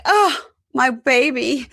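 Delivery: high, loud, slow doting cry; gushing mother. Retold or imitated. Mother greeting her son as he comes out, doting gush. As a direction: gush over your baby, drawn out.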